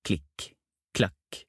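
soft, clipped footstep sounds; distant footsteps. A narrator's reading. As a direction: sound out steps still far away, softly. Footsteps heard before coming nearer. (lave fottrinn på avstand)

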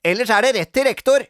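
high, loud scolding; loud, high-pitched telling-off; bossy threat. A narrator's reading. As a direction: threaten like a cross child playing teacher. Cross Grete threatens Sara.

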